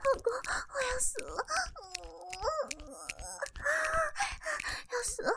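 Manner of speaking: gasping, broken words; breathless moaning. Acted. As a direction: barely get the words out between breaths. Overwhelmed breathless wife. (喘息呻吟着说)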